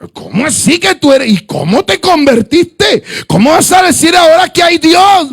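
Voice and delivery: can't accept what he's hearing; incredulous shout. Retold or imitated.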